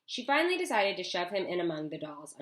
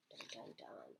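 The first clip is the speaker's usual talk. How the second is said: whispered dun dun dun; 10 semitones lower, 23 dB quieter than usual. Pretend. Dramatic sting after the ship.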